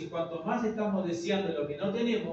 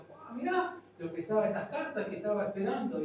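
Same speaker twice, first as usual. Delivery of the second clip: put-on excited boy; 6 semitones higher than usual. Boy opening his card box.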